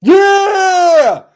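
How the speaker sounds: big high celebratory yell; shout. Real, not acted. Host celebrating the guest's cheer, a loud high yell. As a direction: let it rip like a goal.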